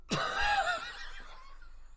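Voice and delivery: piercing squealed laugh; high shrieking laugh. Real, not acted. Stinger sound cracks him up.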